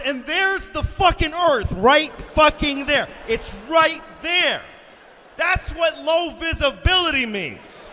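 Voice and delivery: high, loud, stressed exclaiming; alarmed outburst. Acted. Comic reliving the sight of the ground, loud alarm.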